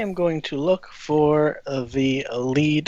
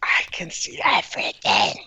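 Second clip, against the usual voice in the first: creepy whisper; 2 semitones higher than usual. Pretend. Joking about seeing viewers.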